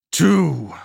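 loud, high single-word roar; furious roar. A narrator's reading. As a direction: roar it out in defiance. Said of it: "still roaring in fury".

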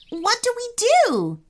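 very high, alarmed question; shouted question. A narrator's reading. Boy asks brother as robot charges, alarmed shout. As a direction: cry it out high, without full volume. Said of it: "shouted Rami".

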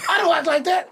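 high, loud indignant denial; lightly voiced offended Jerry West; a hint of Jerry West protesting; mock Jerry West. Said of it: "acting like crazy Jerry West".

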